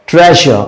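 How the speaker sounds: ट्रेजर with a soft whistling zh in the middle; soft zh sound. Shown as a demonstration. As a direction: let the zh in ट्रेजर hiss softly like a whistle. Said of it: "sound देखिए एकदम अलग से लगा ना". (ट्रेजर में हल्की सीटी जैसी झ़ की आवाज़)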